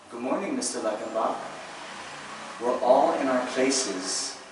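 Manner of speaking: slow schoolchildren's recitation in unison style; class answering teacher. Retold or imitated. Fifth grade pupils answering their teacher, slow drawn-out recitation. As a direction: draw out each phrase like a class reciting by rote. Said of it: "And then our answer".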